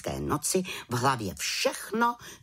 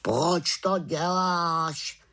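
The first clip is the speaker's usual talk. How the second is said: slow, raised raven voice; tázavý havran; 6 semitones higher, 3 dB louder, much slower than usual. A narrator's reading. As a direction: ask it as a raven, drawing out each word. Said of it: "zeptal se Abraxas". (protáhlá havraní otázka)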